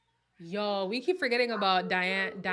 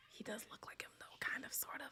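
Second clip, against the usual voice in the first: whisper; 15 dB quieter, faster than usual. Real, not acted. Grudging admission to chat.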